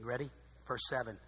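usual speaking voice

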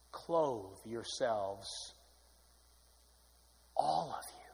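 very slow, soft, low CLOTHE; preacher softly repeating the verse to the hushed room, very slow; hushed refrain. Acted. Whispery-soft slow refrain.

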